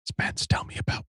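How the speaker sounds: hushed whisper, playing along. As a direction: playfully whisper the request. Plays along with the whisper joke.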